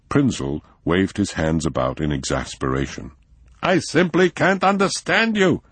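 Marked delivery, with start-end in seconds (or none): 3.6-5.6 much higher, exasperated shout; exasperated at his friend; frustrated outburst. A narrator's reading. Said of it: "he shouted".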